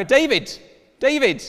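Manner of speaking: loud, high shout of a name; calling out. Retold or imitated. Fan shouting across restaurant queue to celebrity, loud and high. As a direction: yell to get his attention across a queue.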